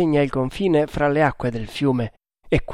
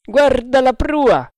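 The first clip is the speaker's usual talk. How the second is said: high, loud, slow call; shouted order; 11 semitones higher, 6 dB louder, slower than usual. A narrator's reading. Quartermaster telling lookout to check the bow, shouting. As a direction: holler it up to the lookout.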